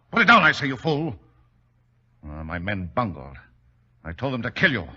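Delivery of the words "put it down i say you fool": shouted order. Acted. Baron orders Holmes to drop book.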